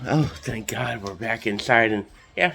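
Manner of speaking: usual speaking voice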